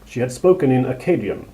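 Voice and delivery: plain reading voice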